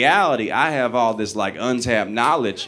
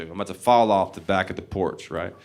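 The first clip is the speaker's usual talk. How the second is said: usual speaking voice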